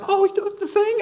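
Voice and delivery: very high, squeaky playful question; jokey falsetto imitation of Jesus; mock Jesus voice. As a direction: squeak it out like a cartoon. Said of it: "He's gonna be like".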